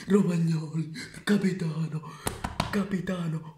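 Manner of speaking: high loud chant of praise; excited shout. Acted. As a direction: belt it like a stadium chant. Goal call chant.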